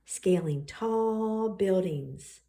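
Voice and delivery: ordinary delivery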